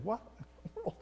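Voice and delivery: "What in the world?" breathed out quietly, stunned; muttered disbelief. Retold or imitated. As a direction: murmur "What in the world?" to yourself. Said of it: "And I'm thinking".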